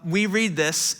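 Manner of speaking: normal talking voice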